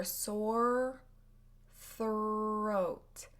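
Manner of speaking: sore throat said slowly word by word; slow drill of sore throat. Shown as a demonstration. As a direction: draw out sore then throat, R clear. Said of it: "The first one is sore throat".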